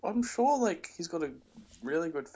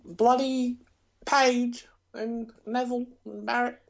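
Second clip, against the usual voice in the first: mock British accent; 11 semitones higher, 4 dB louder than usual. Continuing the British caricature.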